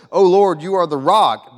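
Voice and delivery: ringing exclamation of faith; proclaiming. Retold or imitated. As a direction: call it out in worship. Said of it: "He says".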